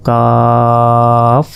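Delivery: কাফ said very slowly, the vowel held long; drawn-out Arabic letter. Shown as a demonstration. Teacher sets কাফ against কফ for students, slowly. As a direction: stretch কাফ out slowly, four alif long. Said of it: "এটা কফ আর এটা".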